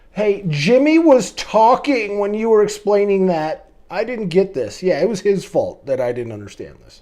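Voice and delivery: loud, high blame-shifting whine; whiny excuse. Pretend. Student blaming classmate to the teacher, loud whiny excuse. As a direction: push the blame onto Jimmy, loudly. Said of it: "Or you could".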